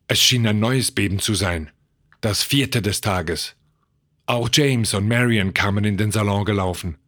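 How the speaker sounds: ordinary delivery, nothing marked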